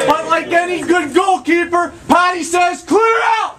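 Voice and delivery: high, slow, shouted narration; big climactic shout. Acted. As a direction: shout out the punchline. Climax of the crowd scene.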